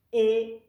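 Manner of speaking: e said loud, high and wide open. Shown as a demonstration. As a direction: belt out e with the mouth wide open. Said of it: "es la e más abierta".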